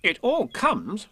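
high, slow, stern reproof; stern Rabbit. A narrator's reading. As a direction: scold sternly, word by word. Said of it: "said Rabbit sternly".

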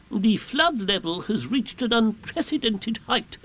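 high, slow, self-important owl; Owl, pompous. A narrator's reading. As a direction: announce it grandly as a learned owl. Owl makes pompous flood report.

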